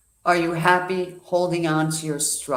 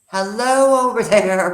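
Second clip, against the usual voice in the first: money calling out; 7 semitones higher, 9 dB louder than usual. Pretend. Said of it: "Money is saying".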